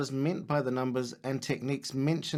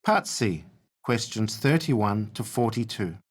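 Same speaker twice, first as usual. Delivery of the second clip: slow, loud formal test instructions; exam announcer; 5 dB louder than usual. Acted. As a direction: read the test rubric clearly.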